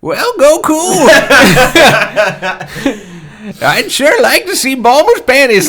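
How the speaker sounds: put-on high cackling old man voice; creaky lecherous old hermit; Master Roshi voice. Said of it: "my old man impression is just Master Roshi".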